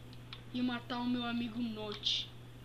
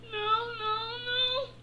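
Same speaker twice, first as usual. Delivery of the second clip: whimpering; 10 semitones higher than usual. Acted. Fleeing in fear.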